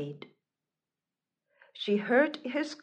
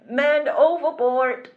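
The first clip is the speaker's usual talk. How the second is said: loud, higher-pitched call of alarm; alarm cry; 4 semitones higher, 6 dB louder than usual. Acted. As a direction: shout an urgent warning over the waves. Alarm call in second storm.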